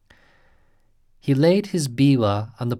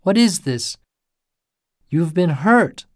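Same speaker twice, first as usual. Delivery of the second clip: high, loud alarmed questioning; a priest alarmed by the wounds; startled alarm; 6 semitones higher, 6 dB louder than usual. A narrator's reading. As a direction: gasp it out in alarm. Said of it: "cried the startled priest".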